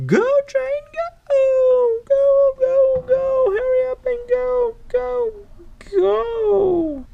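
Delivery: very high, loud squealed urging; frantic judge. Acted. Judge late for the show, high frantic cheering. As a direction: shriek the train onward in a high character voice.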